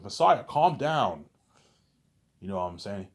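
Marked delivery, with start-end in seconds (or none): 0.0-1.2 higher, louder and slow; loud slow calming. Retold or imitated. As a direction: drag it out to settle him down. Narrator calms Jordan in story.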